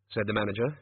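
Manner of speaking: ordinary delivery, nothing marked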